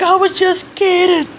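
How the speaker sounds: squeaky high, slow pleading voice through a choke; mock the choked kid with a thin strangled squeak; choked bully pleading. Bully pinned by the throat, gasping that it was a joke, in a squeaky high plea. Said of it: "He's like".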